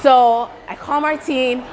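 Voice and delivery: normal talking voice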